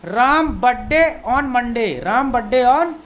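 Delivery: very slow, careful teacher reading; slow model sentence. Shown as a demonstration. Teacher reads the example sentence slowly to students. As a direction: spell it out word by word. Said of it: "दूसरा तरीका है".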